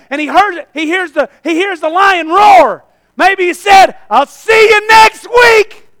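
loud, driving pulpit shout; full-force preaching. Real, not acted. Preacher warning the men about a defeated foe, loud preaching. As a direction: shout it across the church. Preaches, acting the roaring lion.

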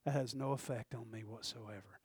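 low, quiet, careless sun; voicing the sun. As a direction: play the sun shrugging it off. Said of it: "The sun would go".

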